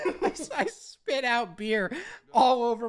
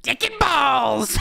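slow shouted cheer; 4 dB louder, slower than usual. Real, not acted. Cheering a crude joke.

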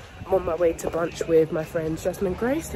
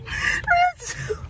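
breathless laughing panic; panicked laughing; 6 semitones higher, slower than usual. Real, not acted. Card missing at drive-through.

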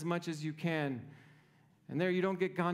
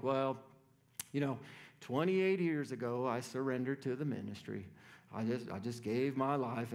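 lower, softer, sighing preacher voice; mock martyr pastor; 7 semitones lower, 3 dB quieter than usual. Pastor mocking colleagues who complain, low self-pitying drone. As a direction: parody a weary martyr, low and soft.

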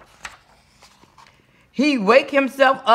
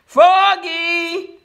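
high, drawn-out name call; long, high-pitched holler; coach's yell; 8 semitones higher than usual. A narrator's reading. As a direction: yell across the field. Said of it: "yelled the coach".

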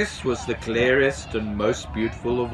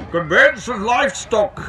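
high, louder official reading voice; station master reading aloud; 9 semitones higher, 5 dB louder than usual. A narrator's reading. Station master reads from the Book of Regulations, officious high voice. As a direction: read out regulations in an officious, raised voice. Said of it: "he read".

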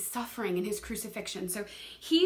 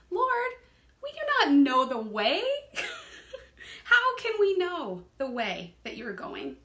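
exasperated cry of "Lord" pitched high, breaking into a laugh; preacher voicing Thomas's frustrated question to Jesus, laughing; 7 semitones higher, 5 dB louder than usual. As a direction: play the bewildered disciple, half laughing. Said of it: "And Thomas is frustrated".

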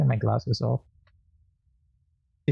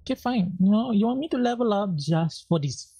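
sarcastic high concession; 8 semitones higher than usual. Real, not acted. Gamer grudgingly conceding to the boss, high and sarcastic. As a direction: say fine like you don't mean it. Resigned, told to level up.

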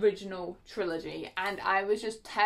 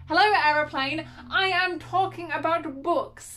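raised, higher voice at an intruding noise; mock-indignant calling out; 7 semitones higher, 5 dB louder than usual. Real, not acted. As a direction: call out over the aeroplane, loudly and pointedly. Calls out over a passing aeroplane.

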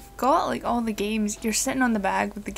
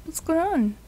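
usual speaking voice

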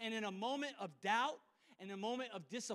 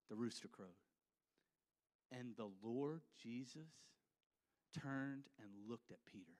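ordinary delivery

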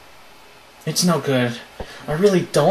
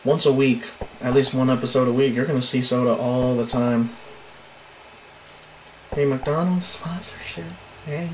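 ordinary talk, nothing marked

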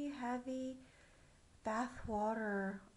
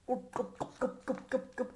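gulping drain sound. Acted. Draining bathwater noise.

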